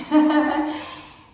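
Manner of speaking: loud, high burst of laughter; real laugh. Real, not acted. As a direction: burst out laughing mid-lesson. Amused by womb position wording.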